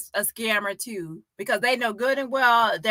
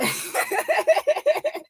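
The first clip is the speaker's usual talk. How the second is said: very high, long cackle; real laughter. Real, not acted. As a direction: break down cackling mid-story. Cracks up retelling the jab.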